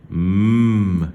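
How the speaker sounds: loud, sustained hummed "M"; isolated sound. Shown as a demonstration. Teacher giving pupils the last sound, hummed "M". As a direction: hum "M" alone, without a vowel. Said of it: "c'est le son".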